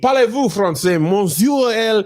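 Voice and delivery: hammed-up French accent on French words. Shown as a demonstration. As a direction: fake a fussy French accent. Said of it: "Give, give us French".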